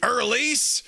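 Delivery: Irlise said as "er-leese", puzzled and rising high; Irlise? asked high in disbelief; imitating a puzzled Irlise; mock Irlise. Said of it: "He's like".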